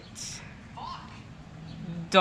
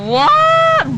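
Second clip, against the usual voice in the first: a shocked squeal, pitch flying way up; shocked high what; 6 dB louder than usual. Real, not acted. Gamer stunned by his friend's move, shocked squeal. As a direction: yelp it in disbelief.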